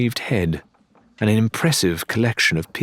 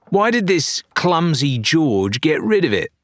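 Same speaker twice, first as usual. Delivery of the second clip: challenging; 8 semitones higher, 3 dB louder than usual. Acted. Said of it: "Lorena challenged".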